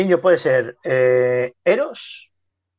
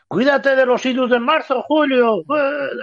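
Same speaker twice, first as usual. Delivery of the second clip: soothsayer; 8 semitones higher than usual. Acted. Acts out the soothsayer's warning to Caesar.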